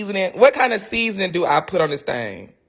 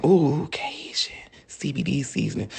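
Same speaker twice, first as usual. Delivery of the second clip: whispered craving; 6 semitones lower, 5 dB quieter than usual. Real, not acted. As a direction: breathe it like a tasty secret. Savouring the seasoning idea.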